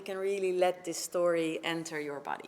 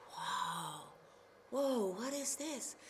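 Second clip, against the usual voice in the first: soft, awed whoa; hushed wonder; 9 dB quieter, slower than usual. Acted. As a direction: marvel quietly at the deer.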